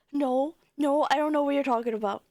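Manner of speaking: usual speaking voice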